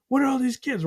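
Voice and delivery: put-on a scared child seeing a crowd; overwhelmed toddler.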